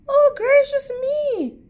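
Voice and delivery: put-on high, startled girlish voice; prim little girl. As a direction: gasp it out like a proper little girl.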